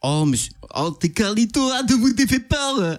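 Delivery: startled greeting in a mock Belgian accent. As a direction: put on a broad Belgian accent. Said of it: "vous avez fait l'accent belge".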